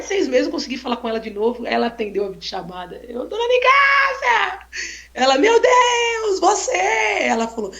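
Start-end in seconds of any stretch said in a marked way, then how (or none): 3.3-4.6 high, slow, delighted call of her name; excited greeting. Retold or imitated. Greeting the old lady on video call, delighted high call. 5.4-6.8 high shouted astonished exclamation; an old woman thrilled to be called; surprised old lady. Retold or imitated. Old lady answering the video call, high astonished shout.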